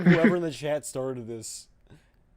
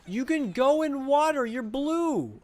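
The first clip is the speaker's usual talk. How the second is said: excited yell; 10 semitones higher, 4 dB louder than usual. Real, not acted. Yelling at game teammates.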